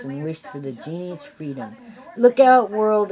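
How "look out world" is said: much higher, much louder joyful shout; exclaiming. A narrator's reading. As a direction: burst out with freedom. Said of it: "exclaimed the genie".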